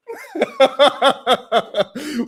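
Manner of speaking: real, loud burst of laughter; loud belly laugh. Real, not acted. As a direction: crack up loudly at your own joke. Host cracking up at own joke.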